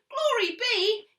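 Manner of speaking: high, loud slow exclamation; old lady crying out as the goat wrecks the house; cried out. A narrator's reading.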